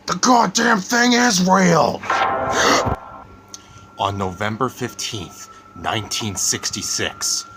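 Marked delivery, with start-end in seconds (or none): 0.1-2.0 a huge, slow, high shout; furious shout. Acted. A character yells at doubting friends, furious shout. Scared character cuts off skeptic. 2.1-3.2 gasp. Acted. Shocked gasp after the outburst.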